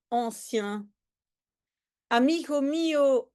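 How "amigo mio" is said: put-on lightly Spanish-accented, higher greeting. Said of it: "on va en fait imiter l'accent espagnol". (salut au léger accent espagnol)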